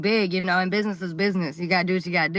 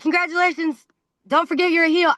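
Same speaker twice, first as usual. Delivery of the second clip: higher voice, a bit mocking; opponent's smug congratulations; 7 semitones higher, 4 dB louder than usual. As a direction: hand her the win with a smirk.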